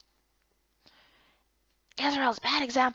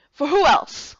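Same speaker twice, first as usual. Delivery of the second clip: put-on louder, commanding older woman; firm orders, no room to argue; stern Miko; 4 semitones higher, 8 dB louder, slower than usual. Miko answers curtly.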